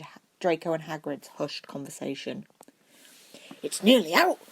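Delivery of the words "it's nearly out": loud, high burst of excitement; excited. A narrator's reading. Hagrid letting Neville and Hermione in, excited. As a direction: blurt it out thrilled. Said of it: "Hagrid said in excitement".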